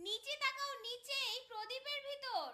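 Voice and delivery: very faint, tiny calling voice; faraway trapped voice. Acted. Trapped daughter directs family to the lamp, faint and distant. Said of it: "মনে হচ্ছে যেন অনেক দূরের আওয়াজ".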